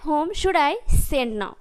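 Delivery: very slow, word by word; slow, careful model sentence; slow dictation. Shown as a demonstration. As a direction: spell it out slowly for the class. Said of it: "teacher models the English sentence".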